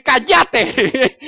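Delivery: high, sharp order to be silent; mock-annoyed silencing; scolding hush. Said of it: "vienen los apóstoles y dicen".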